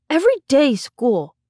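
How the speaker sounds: put-on high child voice; whiny small boy listing his grievance; complaining little boy. A narrator's reading.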